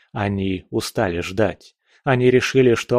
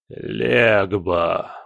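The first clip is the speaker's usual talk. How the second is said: summoning call with a grating edge; herald voice like metal on glass. A narrator's reading. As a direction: call out the name like scraping metal. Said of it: "Голос глашатая прозвучал, как скрежет металла".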